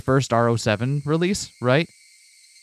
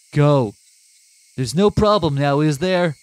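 stern command; 4 semitones higher, 4 dB louder, slower than usual. Acted. Keiichi curt command.

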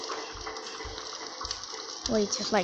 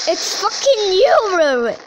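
much higher and louder, raging yell; furious shout; 7 semitones higher, 8 dB louder than usual. Real, not acted. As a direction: scream it at the screen in fury. Furious at returning enemy.

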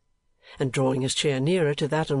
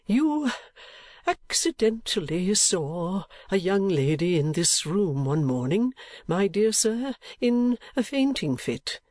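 put-on halting, mostly higher-pitched old gentleman; kindly old gentleman, reminiscing; 7 semitones higher, slower than usual. Old gentleman reminding Nicholas of the fainting lady, reminiscing. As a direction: prompt his memory with pauses.

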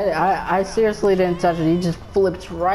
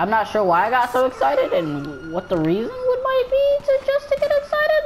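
climbing squeal; 6 semitones higher than usual. Pretend. Player explaining his excitement, climbing into a squeal. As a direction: start normal, rise into a very high squeak.

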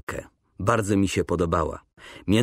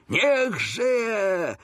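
high, long, fuller-voiced cheer; shouted cheer; 10 semitones higher than usual. A narrator's reading. Coretti cheering the king with others, high shout. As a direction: shout it high along with the crowd. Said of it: "krzyknął z innymi Coretti".

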